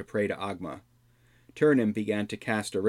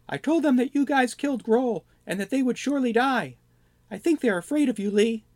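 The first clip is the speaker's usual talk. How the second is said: high, louder goblin voice; a nervous goblin reporting to the party; 5 dB louder than usual. Acted. Goblin voice held.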